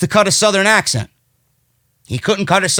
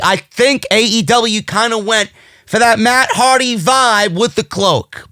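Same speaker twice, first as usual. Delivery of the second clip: usual speaking voice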